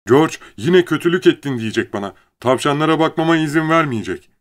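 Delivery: high, loud, worried complaint; anxious pleading. A narrator's reading. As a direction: plead as if dreading punishment. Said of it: "diye yalvardı". (endişeyle yakınarak)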